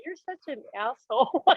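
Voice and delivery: high mock yell, kept soft; retold angry yelling. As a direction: act out yelling without the volume. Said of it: "I was yelling at him".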